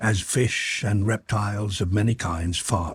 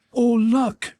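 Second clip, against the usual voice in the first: high, louder burst of joy; joyful cry; 14 semitones higher, 4 dB louder than usual. A narrator's reading. Girl spotting her homeland, crying out with joy. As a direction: cry out in sudden delight. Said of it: "she cried".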